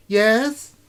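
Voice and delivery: put-on high, loud girlish voice; a young girl calling out. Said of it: "She's like".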